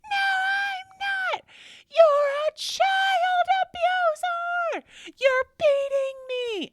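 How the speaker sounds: mother imitating her daughter's screaming accusation; mock crying shriek. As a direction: shriek it like a hysterical teen. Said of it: "And she was like".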